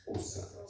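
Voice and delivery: Hosa with an attempted click for the first sound. Shown as a demonstration. Host trying an African name's language, tentative click on Hosa. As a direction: try clicking into the start of Hosa, tentatively and softly. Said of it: "you click".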